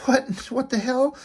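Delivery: panicked, high-pitched cries of disbelief; freaking out. Retold or imitated. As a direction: voice jumps up in a half-laughing panic. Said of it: "He's freaking out".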